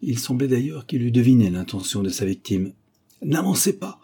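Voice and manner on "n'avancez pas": high, sharp warning; hissing threat. A narrator's reading. Masked gunman stops his victim advancing, hissing threat. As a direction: warn him off with menace. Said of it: "dit-il d'une voix sifflante".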